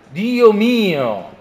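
a pathetic, theatrical exclamation. A narrator's reading. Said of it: "esclamò Rudin".

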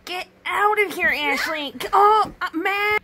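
shrill furious yell at sister. Acted. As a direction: scream at her to get out. Yelling at intruding sister.

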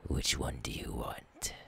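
menacing whisper. Acted. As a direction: breathe the threat softly, unvoiced. Menacing captive, whispered threat.

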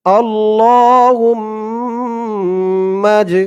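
আল্লাহুম্মা drawn out very slowly with long hold; slow recitation. Shown as a demonstration. Teacher modelling pronunciation and elongation, slow recitation. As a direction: intone আল্লাহুম্মা with a long stretch. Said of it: "আমি যেই শব্দ যেমন উচ্চারণ করব, যেমন টান দিব".

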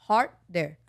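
slow "harder" with an English r; drawn-out, careful "harder". Shown as a demonstration. A host teaching her sister the word slowly.

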